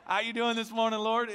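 loud, high, easygoing chat with God; casual country greeting. Retold or imitated. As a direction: talk to God like a neighbor. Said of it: "I'm all country".

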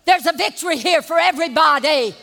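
firm, loud correction of a rude mom; mock scolding shout; retold shout. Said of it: "what I was wanting to say".

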